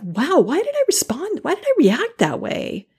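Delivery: high-pitched, baffled self-questioning; acting out wondering at your own overreaction; mock self-questioning. Quoting yourself after overreacting.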